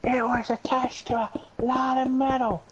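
high-pitched mock storytelling; put-on silly voice. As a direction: pitch it up and stretch it out playfully. Carries on the silly voice.

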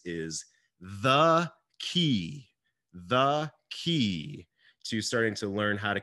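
"key" drawn out slowly and stressed. Shown as a demonstration. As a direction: lean hard on "key", very slowly. Coach stressing the main point.

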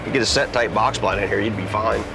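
ordinary talk, nothing marked

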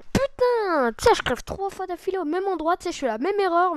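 loud, high, exasperated rant; frustrated rant. Real, not acted. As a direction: vent the frustration loudly. Dying three times in a row.

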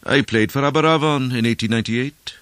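high, loud proud claim; boastful stranger. A narrator's reading.

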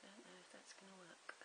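soft worried whisper; whispered doubt. Real, not acted. Crafter doubting the glue, whispering to herself. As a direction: breathe it to yourself, unsure.

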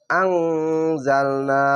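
আংজালনা, very slow, with a sustained nasal "ng"; ikhfa ghunna. Shown as a demonstration. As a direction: hum the ং of আংজালনা long before "zal". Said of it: "এখানে ইকফা গুন্না".

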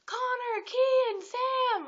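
high, drawn-out fan yell. Retold or imitated. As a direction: imitate calling out excitedly to the boys. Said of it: "We're just yelling at them".

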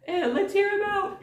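very high put-on voice; squeaky-high pretend bystander piping up; fake crowd member. Gil pretending to be someone in the crowd, high fake voice. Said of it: "pretending to be people in the crowd".

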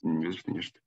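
lisping, s sounds slushy like sh. Real, not acted. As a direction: speak with a slushy lisp. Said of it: "Я шепелявлю".

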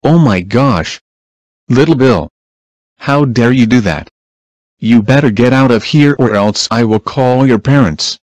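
loud, furious scolding; angry shout. Acted. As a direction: yell at him in outrage. Scolding Little Bill for killing Blue.

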